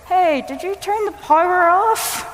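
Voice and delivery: high, slow call through a door with a mouthful; toothbrushing mumble. Retold or imitated. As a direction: imitate calling out while brushing teeth. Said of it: "I kind of mumble through the door".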